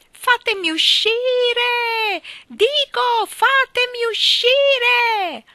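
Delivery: very high, drawn-out screams; long wailing shout for release; desperate screaming. Acted. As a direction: scream so it carries from far up in the sky. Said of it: "La sua voce giungeva fino a loro".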